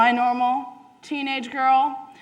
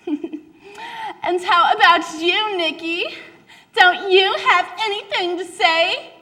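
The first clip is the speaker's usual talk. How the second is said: much higher, slow, laughing taunt; teasing teen girl; 9 semitones higher than usual. Acted. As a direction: needle the odd girl out. Girls turn to Nikki.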